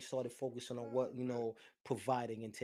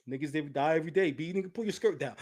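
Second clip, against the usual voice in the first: imitating Cameron; 2 semitones higher, 9 dB louder than usual. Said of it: "Cameron is just right there".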